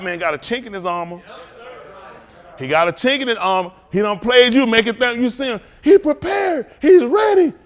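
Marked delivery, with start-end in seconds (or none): 5.8-7.5 mock boast. Mocking the enemy's claimed readiness.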